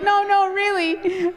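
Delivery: high-pitched imitation of earnest insistence; insistent protest. Wife imitating husband blaming the spices, insistent. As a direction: protest lightly, voice pitched well up.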